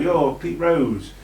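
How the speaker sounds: ordinary talk, nothing marked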